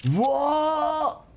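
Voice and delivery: high startled exclamation; surprised whoa. Real, not acted. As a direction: react to a sudden shock. Sudden surprise in game.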